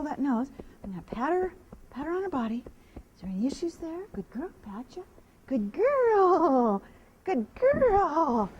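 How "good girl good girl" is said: louder, high drawn praise; hearty praise. Real, not acted. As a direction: cheer the mare on warmly. Loud praise to horse.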